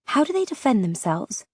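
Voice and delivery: plain reading voice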